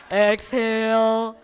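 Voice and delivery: loud, slow exhale instruction; slow coached exhale. Retold or imitated. Engineer husband coaching his panicking wife to breathe, slow and loud. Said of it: "he's the first one I call".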